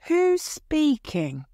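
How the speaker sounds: high, slow and scared; frightened. A narrator's reading. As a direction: ask the dark, afraid. Frightened aunt asks the ghost.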